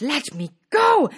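high, loud protest; shout. A narrator's reading. Cindy grabbed by the prince, high loud shout. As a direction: shout it to break free. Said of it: "As Cindy shouted".

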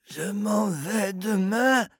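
slow, high, strained hoarse plea; a man at the barrier pleading hoarsely. Acted. Hoarse man leaning on the barrier. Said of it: "d'une voix enrouée".

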